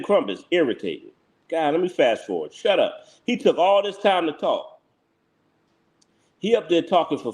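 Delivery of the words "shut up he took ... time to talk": normal talking voice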